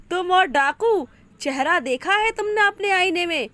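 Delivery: taunting rival pirate mocks him; mocking. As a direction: sneer at the puny pirate. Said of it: "खिल्ली उड़ाता है".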